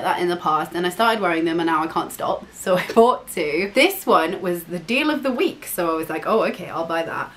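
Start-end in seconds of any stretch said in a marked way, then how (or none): none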